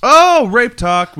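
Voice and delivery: high, loud and slow; excited. Acted. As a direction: stretch it out gleefully. Excited jokey echo of segment.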